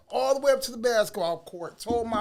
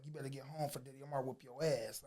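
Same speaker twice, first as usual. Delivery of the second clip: lower, softer quoted brother; low-key retold warning; 6 semitones lower, 12 dB quieter than usual. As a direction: pass on a warning quietly, retelling it. Brother relays the warning on court.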